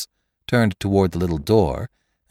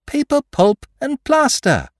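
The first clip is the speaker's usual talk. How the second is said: 1 exultant; 4 dB louder than usual. A narrator's reading. Exultant reveal.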